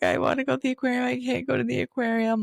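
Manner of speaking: put-on high, fast childish whine; whiny. Whining about missing the aquarium.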